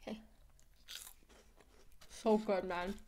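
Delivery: talking between bites; chewing ice cream. Real, not acted. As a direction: mouth partly full. Seen 2: Left woman bites cone before, lips pressed, chewing while holding cone.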